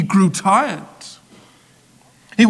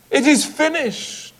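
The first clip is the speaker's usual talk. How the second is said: higher, ringing cry of victory; victorious cry; 8 semitones higher than usual. A narrator's reading. Jesus on the cross crying out, triumphant and high. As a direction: announce the triumph. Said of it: "cried out in victory".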